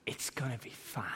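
whispered reassurance. Retold or imitated. Dad whispering to his son that all is fine, whispered. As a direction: breathe it out as a whisper.

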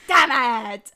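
loud yelled catchphrase; mock shout. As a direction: yell it back at full volume.

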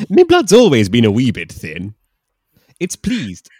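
loud mock Scottish brogue; a hammy Scot; Scottish man.

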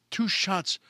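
ordinary delivery

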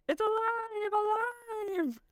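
high mad-scientist cackle voice; cartoonish evil shout. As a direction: ham it up like a cartoon villain. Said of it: "shouted with a cartoonish evil laugh".

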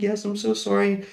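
a hint of a scared kid saying sorry; mild nervous young voice; flustered apology. Himself as flustered late kid.

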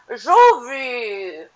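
loud, high imitation of Yara's thick accent; hammed-up accent, as if talking through a mouthful of food; mock mouth-full accent. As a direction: send up her accent, loud and round-mouthed. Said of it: "sounds like her mouth is full".

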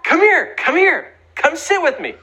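high, eager call across the bus. Retold or imitated. Boy on the bus calling the bully to sit, high eager call. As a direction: call the bully over brightly. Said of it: "calls out his name".

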